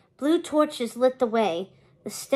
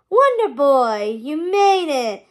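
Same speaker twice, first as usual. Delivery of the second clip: sneering Hades; 3 semitones higher, 7 dB louder, slower than usual. A narrator's reading. Hades greets Wonder Boy from his throne, sneering. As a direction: stretch out a mocking welcome, loudly. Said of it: "Hades sneered from his perch".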